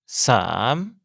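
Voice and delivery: "Sam" with a long A, rising like a question. Shown as a demonstration. Teacher modelling Thai three, long "Sam" with rising tone. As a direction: start "Sam" low and stretch it upward questioningly. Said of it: "la voyelle est longue et le ton se prononce comme une question".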